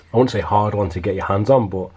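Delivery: ordinary delivery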